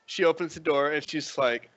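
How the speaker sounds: ordinary talk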